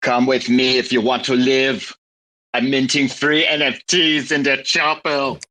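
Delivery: a hint of Arnie; Arnie impression. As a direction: deliver it like the Terminator. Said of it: "doing the Arnie impression".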